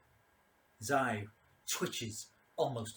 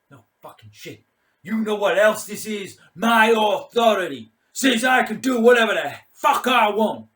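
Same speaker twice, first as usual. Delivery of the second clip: put-on drunken bullying commander; 10 semitones higher, 18 dB louder than usual. Mad Mike boasting of his authority to Keen, rising shout. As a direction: start with a growl, then roar your authority.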